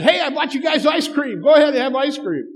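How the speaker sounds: high, loud, eager treat offer; cheery dad. Retold or imitated. Dad offering the boys ice cream, eager and high. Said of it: "And I say".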